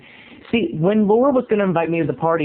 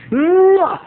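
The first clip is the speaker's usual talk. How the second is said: loud blown kiss; 11 semitones higher, 5 dB louder than usual. Real, not acted.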